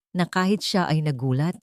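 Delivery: plain reading voice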